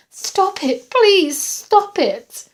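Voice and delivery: high, loud, panicked cries; desperate begging. A narrator's reading. Patient crying to stalker to stop, desperate begging. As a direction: cry out and plead.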